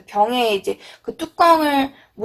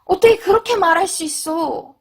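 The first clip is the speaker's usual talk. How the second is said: higher-pitched hurt reproach; 감정이 상한 듯; 6 semitones higher than usual. Shown as a demonstration. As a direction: protest as if your feelings are hurt. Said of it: "여러분 막 감정적으로 상했을 때". (상처받은 듯 높은 톤으로 따지기)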